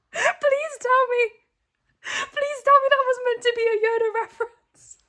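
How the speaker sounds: high, loud laughing pleas; helpless laughter. Real, not acted. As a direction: beg through laughter, barely holding it. Losing it over the book.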